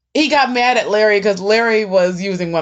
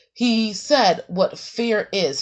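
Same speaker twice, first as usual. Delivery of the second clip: ordinary talk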